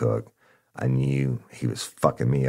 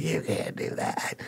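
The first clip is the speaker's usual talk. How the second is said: whispered protest; 8 semitones higher, 8 dB quieter, slower than usual. Retold or imitated. Informant protesting arrest, whispered.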